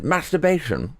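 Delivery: a lightly hammed Masturbation; mock unsexy word. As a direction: say Masturbation in a silly put-on voice. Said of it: "such an unsexy word".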